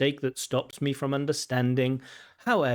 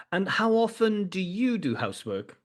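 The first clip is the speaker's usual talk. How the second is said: ordinary talk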